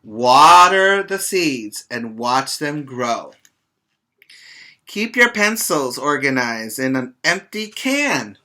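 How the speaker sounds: put-on lively read-aloud for little kids, a bit slow in places; reading to children. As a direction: read it out warmly to a group of children. Reads picture book to children.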